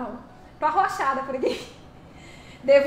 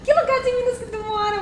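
laughing squeal; 9 semitones higher than usual. Real, not acted. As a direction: tease her while still laughing. Still laughing, teasing question. (pergunta aguda entre risos)

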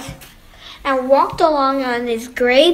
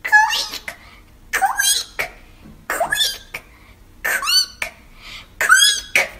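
very high squeaky creaks; creaking floorboards; much slower than usual. A narrator's reading. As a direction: squeak each word like a floorboard. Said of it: "the floorboards begin creak".

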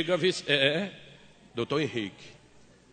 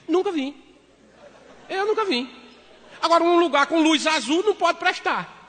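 higher, louder protesting husband; indignant protest; 10 semitones higher, 4 dB louder than usual. Retold or imitated. Husband to wife at the cabaret, indignant denial. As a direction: swear you've never been there.

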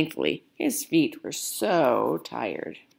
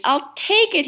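put-on a much higher, louder, delighted old man's voice; beaming Grandpa; 12 semitones higher, 6 dB louder than usual. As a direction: Grandpa thrilled with his find. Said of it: "he said".

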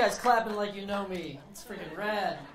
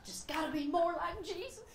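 higher mock mom voice; imitating mom; 9 semitones higher than usual. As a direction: nag like a churchy mom. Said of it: "my mom's like".

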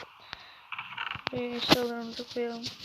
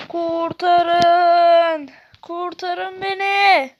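high, loud, drawn-out plea; mock cry for help; 7 semitones higher, 12 dB louder, much slower than usual. Gamer wails for rescue while lost. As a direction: wail for rescue, half-joking.

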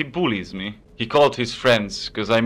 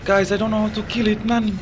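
higher, panicked plea for help; 8 semitones higher than usual. Real, not acted. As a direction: beg the chat for help in a panic. Panicking in boss fight.

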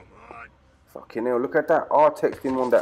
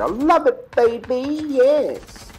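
much higher and louder, overjoyed; ecstatic cry; 7 dB louder, much slower than usual. Real, not acted. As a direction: scream with delight at the kill. Thrilled by a gory kill.

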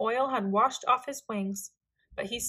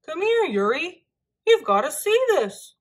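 higher, loud eager call; eager cry; 6 semitones higher, 6 dB louder than usual. A narrator's reading. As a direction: call a friend over eagerly. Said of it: "cried Lars eagerly".

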